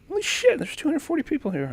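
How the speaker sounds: stunned, high exclamation; real amazement. Real, not acted. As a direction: marvel at the turnout, voice way up. Reacts to rising viewer count.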